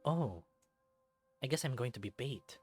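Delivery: usual speaking voice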